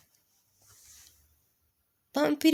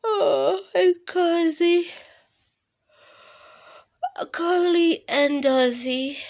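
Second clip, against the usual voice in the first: cozy; 5 semitones higher, 3 dB louder than usual. Acted. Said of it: "happy to be quiet".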